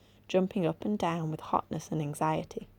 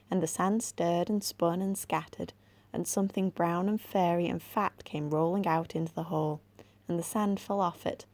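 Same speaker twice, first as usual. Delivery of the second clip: usual speaking voice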